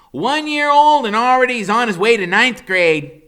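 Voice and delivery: put-on high, loud wisecracking boy; Freak's high smart-aleck voice. A narrator's reading. Boy joking about his own birthday, high and cocky. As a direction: crack the joke like a cocky kid. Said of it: "Freak says".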